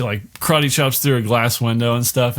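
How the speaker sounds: ordinary talk, nothing marked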